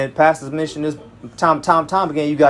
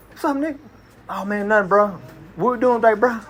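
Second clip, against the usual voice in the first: higher, slightly softer buddy greeting; casual street slang; 7 semitones higher, 3 dB quieter than usual. Shown as a demonstration. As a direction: demonstrate easy slang banter between friends. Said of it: "that's how we used to talk".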